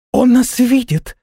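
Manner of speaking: high, drawn-out thrilled cry; delighted exclamation. A narrator's reading. Flying girl realises the god sees them, delighted. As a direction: cry out in high-pitched rapture. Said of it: "воскликнула в восторге та".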